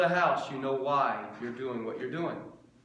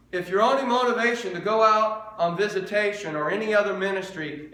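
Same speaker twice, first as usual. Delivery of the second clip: louder and higher, hammering each repeat; full-force preaching; 5 semitones higher, 6 dB louder than usual. Acted. Pastor telling the church what kept him going, preaching hard. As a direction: drive the point home line after line.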